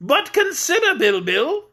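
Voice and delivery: high, jolly voice of a stout king; fat jolly king. Acted. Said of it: "remonstrated the king".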